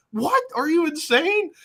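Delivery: high, incredulous outburst; hammed-up shock; mock disbelief. Taz reacting in retold story.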